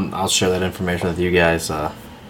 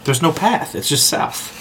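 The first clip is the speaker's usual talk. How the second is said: exasperated; 8 semitones higher, slower than usual. Acted. Retorting to the mimicked guide.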